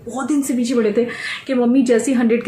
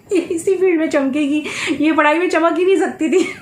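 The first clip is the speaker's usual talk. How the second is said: laughing; 6 semitones higher than usual. Real, not acted.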